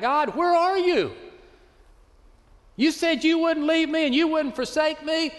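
high, pleading, anguished call; desperate cry out to God. Retold or imitated. Preacher voicing a believer crying out to God, high anguished plea. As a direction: cry out like a lost believer begging for answers. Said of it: "we just cry out".

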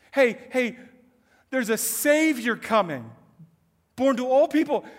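loud announcing call to the crowd; calling out news. Retold or imitated. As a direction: shout the good news across the field.

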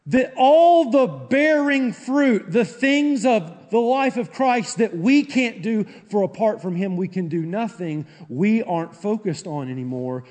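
loud, high preaching, easing toward the end; impassioned pulpit delivery; full-force preaching. Real, not acted. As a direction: preach it out loud, then start to come down.

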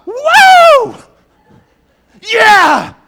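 huge high whooping cheer; going nuts. Acted. Preacher acting out a winning underdog team, wild cheering. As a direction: holler like an underdog who just won. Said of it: "No, they go nuts".

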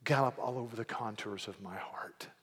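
soft, low, intimate prayer; quiet prayer. Retold or imitated. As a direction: pray it under your breath, longing. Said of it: "you say".

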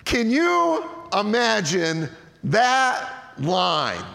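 drawn out slowly, amused; slow savoring. Real, not acted. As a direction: stretch the question out. Savoring the funny verse.

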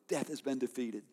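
ordinary delivery

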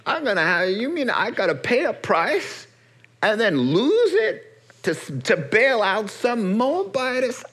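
squeaky, scandalised whine at bailing out a Moabitess; high indignant kinsman. Acted. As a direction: play the greedy relative balking at the cost. Kinsman balks at paying the price.

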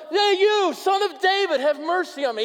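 high, loud yell over the crowd; shouting out. Acted. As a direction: refuse to be silenced, shout it. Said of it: "He's yelling it".

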